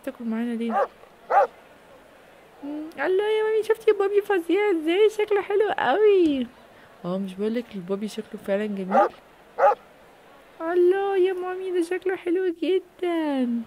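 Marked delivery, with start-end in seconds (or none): 2.6-6.5 put-on high, excited little girl voice with a drawn-out close; a small girl thrilled by the puppy; child's voice. Little girl gushing over the dog. 10.6-13.6 put-on high, excited little girl voice with a drawn-out close; a small girl thrilled by the puppy; child's voice. Little girl gushing over the dog.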